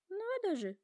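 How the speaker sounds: high, wondering giraffe voice; musing giraffe. A narrator's reading. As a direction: marvel at it, pitched high. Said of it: "призадумалась Жирафа".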